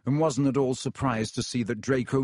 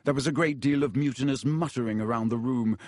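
ordinary delivery, nothing marked

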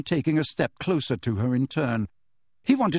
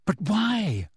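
persistent; 8 semitones higher than usual. Acted. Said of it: "Hermione persisted".

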